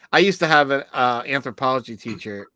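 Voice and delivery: normal talking voice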